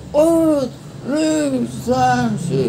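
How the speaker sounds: high, drawn-out training count; teriakan hitungan. Shown as a demonstration. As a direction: call out the count at a high pitch, stretching each number. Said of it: "disuruh ngitung". (hitungan latihan diteriakkan panjang dan tinggi)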